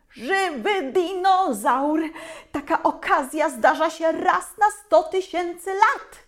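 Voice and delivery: high, loud gleeful exclaiming; overjoyed teacher. A narrator's reading. Nature teacher thrilled by a live dinosaur, high gleeful exclaiming. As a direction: burst with delight at the find.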